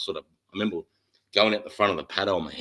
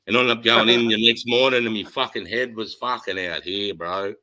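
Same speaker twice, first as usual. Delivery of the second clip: usual speaking voice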